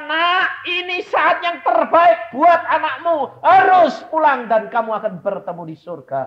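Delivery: high, loud divine pronouncement; voice of God. Retold or imitated. God speaking to the grieving mother, loud proclamation. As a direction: speak as God calling her child. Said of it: "Tapi Tuhan berkata".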